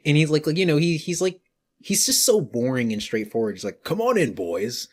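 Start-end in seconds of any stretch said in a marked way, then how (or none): 3.8-4.9 mayor voice. Retold or imitated. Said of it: "the mayor's voice is so funny".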